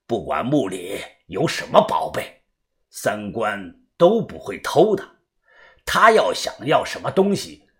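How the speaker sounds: low, quiet, slowish elder's voice; soft low-pitched elderly persuasion; old man. A narrator's reading. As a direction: reason gently as an old master.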